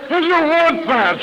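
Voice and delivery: slow, high, grossed-out question; disgusted. Retold or imitated. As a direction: recoil at the stale donut. Newcomer eyes the stale donut.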